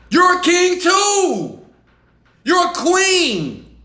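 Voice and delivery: high, drawn-out proclamation; hyping up. Acted. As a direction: crown the listener with long, high, ringing words. Hypes viewers up as royalty.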